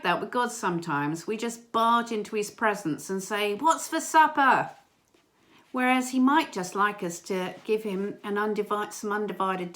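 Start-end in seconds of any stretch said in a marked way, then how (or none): 3.5-4.7 loud, high, slowed-down demand; mock childlike call, pitched up; barging-in demand. Barging in, echoing the boys.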